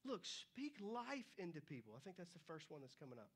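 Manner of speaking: ordinary talk, nothing marked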